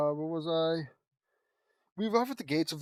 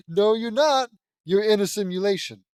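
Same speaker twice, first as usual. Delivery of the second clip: loud, raised-pitch correction; emphatic contradiction; 4 semitones higher, 10 dB louder than usual. Real, not acted. Reader correcting the story's claim, emphatic contradiction. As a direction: break in loudly to set it straight.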